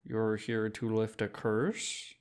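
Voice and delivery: put-on slow, skeptical Czech-accented question; doubtful shopkeeper in a Czech accent; dense Czech accent. Shopkeeper doubting the drunk, slow Czech accent. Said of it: "She raised her brows".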